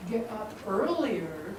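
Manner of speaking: ordinary talk, nothing marked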